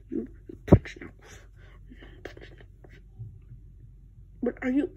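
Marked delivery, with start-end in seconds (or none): 0.1-3.0 very soft, voiceless mumble; barely audible muttering to yourself; mumbling. Acted. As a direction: mumble under your breath. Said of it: "mumbling or whatever".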